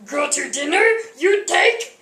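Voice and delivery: lightly voiced Yoda, pitch climbing on the question; quick mock Yoda line. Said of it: "he would not stop talking like Yoda".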